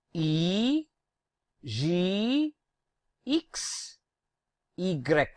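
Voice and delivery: E, G, X, Y as French names with ee; drawn-out ee opening or ending each letter; "ee" sound. Shown as a demonstration. Teacher groups similar alphabets, drilling the ee sound. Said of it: "have the E sound".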